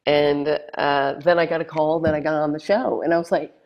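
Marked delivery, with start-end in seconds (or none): none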